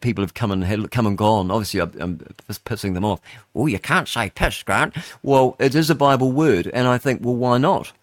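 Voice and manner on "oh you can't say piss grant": put-on voice of a scandalised listener; mock objector. Listener telling off the host for swearing, in mock reproach. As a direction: mimic someone objecting to a rude word.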